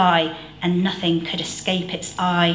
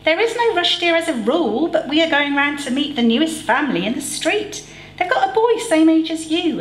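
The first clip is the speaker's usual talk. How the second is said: mum voice; 9 semitones higher, faster than usual. A narrator's reading.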